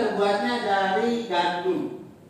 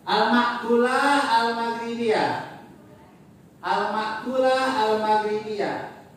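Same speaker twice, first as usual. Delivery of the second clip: slow teaching read; slower than usual. Shown as a demonstration. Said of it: "teacher repeats Arabic title slowly".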